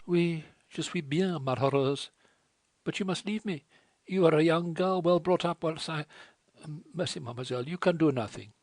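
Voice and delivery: put-on a wretched girl begging her to leave; distressed French girl. A narrator's reading.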